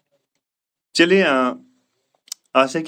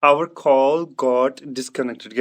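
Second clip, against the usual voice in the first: slow model sentence; 3 semitones lower, much slower than usual. Shown as a demonstration. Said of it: "teacher models the answer".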